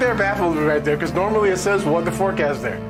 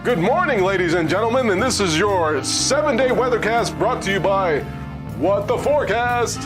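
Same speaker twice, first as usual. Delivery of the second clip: announcer voice; 3 semitones higher than usual. Acted. Weathercast intro retake.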